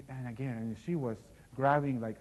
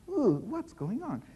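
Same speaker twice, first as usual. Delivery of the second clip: high, slow, recoiling ooh; disgusted surprise; 11 semitones higher, 7 dB louder, slower than usual. Acted. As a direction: wrinkle your nose and draw it out. Knight smells awful smell.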